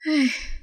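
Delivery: resigned sigh. A narrator's reading. Hoàng gives in to his friend's teasing, sighing. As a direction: let out a short sigh. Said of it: "chỉ thở dài rồi nói thật".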